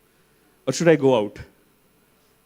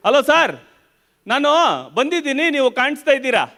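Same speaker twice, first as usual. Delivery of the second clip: higher, fast eager cab driver voice; high-pitched cab driver; 7 semitones higher, much faster than usual. Retold or imitated. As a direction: chirp it quickly into the phone. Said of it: "he said".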